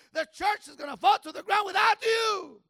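high, loud preaching shout. Acted. Pastor warning the congregation, loud preaching shout. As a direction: hammer it home at full voice. Climax of sermon.

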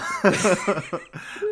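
high amused laugh; a burst of real amusement; giggling at the bet. Real, not acted.

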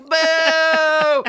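long, loud drawn-out boo; mock heckling, loud and sustained; imitated crowd booing. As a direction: jeer like a whole audience. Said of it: "they're like".

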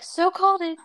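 high, smug teasing voice; smug told-you-so. Retold or imitated. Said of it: "She's like".

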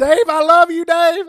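high, loud, gushing shout; loud gushing plea. Pretend. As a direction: yell it like a desperate fan. Shouting plea to [name].

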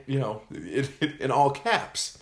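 ordinary talk

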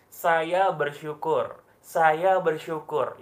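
"Saya bersyukur" said very slowly, each word weighed; drawn-out, deliberate affirmation. Shown as a demonstration. Coach slowly models the gratitude phrase for viewers, deliberate and calm. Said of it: "Ini penting ya".

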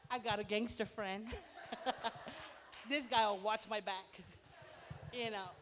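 mock tough brag. Bragging about gangster friend protection.

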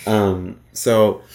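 ordinary talk

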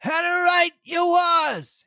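put-on high, slow old castaway cry; crying out as Ben Gunn. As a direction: cry it out like a wild old sailor. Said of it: "he cried".

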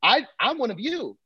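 high pleading at the door; mock plea. Comic pleading with bar staff, high pitched. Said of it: "I'm like".

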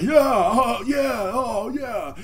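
high put-on voice, fading in volume; forced agreement. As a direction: play along with hammed-up enthusiasm. Imitates friend going along, high voice.

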